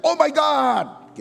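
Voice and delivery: high, drawn-out mock cry of shock; startled shout. Preacher mimicking Thomas startled by Jesus, high shout. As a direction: yell it out as if caught by surprise. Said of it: "langsung dia teriak".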